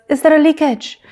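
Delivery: put-on heavy French accent. Imitating a racing driver on the radio, heavy French accent. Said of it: "sounding more French than ever before".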